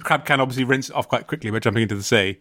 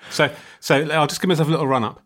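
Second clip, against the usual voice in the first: ordinary talk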